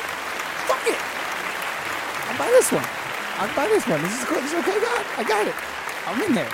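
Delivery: high, loud and eager; excited, eager. Retold or imitated. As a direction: answer like an overjoyed believer. Eagerly answering God.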